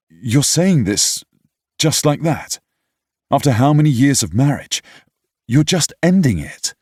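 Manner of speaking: son's voice rising in shocked protest; upset outburst. A narrator's reading. Son confronting his parents about divorce, loud disbelief.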